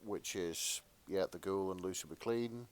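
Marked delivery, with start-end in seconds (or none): none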